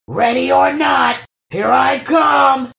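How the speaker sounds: booming high shout; thundering troll. Acted. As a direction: thunder it across the lake. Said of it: "thundered the troll".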